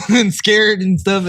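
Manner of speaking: high giggling through words; laughing fit while talking. Real, not acted.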